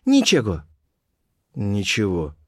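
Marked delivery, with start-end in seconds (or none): 0.1-0.6 put-on high-pitched girl's reply; Colibri's voice. A narrator's reading. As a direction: pitch it up for the girl, then let it fall. Colibri repeats her answer.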